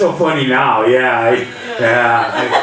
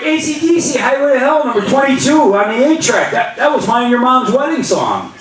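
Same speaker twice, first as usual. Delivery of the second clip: mock dad rant; 8 semitones higher than usual. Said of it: "I'm like".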